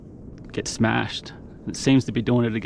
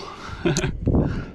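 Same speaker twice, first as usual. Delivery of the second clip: happy laugh; 4 dB quieter than usual. Real, not acted. Delighted after the catch.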